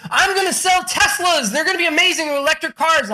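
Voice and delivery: high-pitched excited pitch; Elon Musk, fired up. Retold or imitated. Entrepreneur promises electric cars to the world, fired up. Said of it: "Elon Musk got all fired up".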